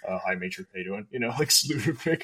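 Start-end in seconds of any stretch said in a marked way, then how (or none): 0.1-1.1 meek, trying to smooth things over; sheepish greeting. Retold or imitated. Airman sheepishly greeting the angry major, soft and low. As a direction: lower and much softer, timid.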